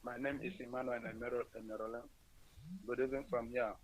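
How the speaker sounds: faint, distant phone voice; barely there on the line; barely audible. Real, not acted. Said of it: "Your voice is very faint".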